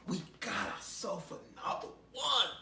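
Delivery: voiced demon gloating. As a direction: crow over the catch out loud. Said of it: "They drag you like".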